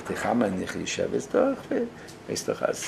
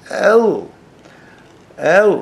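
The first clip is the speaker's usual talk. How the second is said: loud, high-pitched cry of a single word; mashgiach's shout; 8 semitones higher, 8 dB louder, much slower than usual. Retold or imitated. Mashgiach standing up crying out to students, imitated loud shout. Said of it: "דער משגיח איז צוגעשטאנען און געשריען".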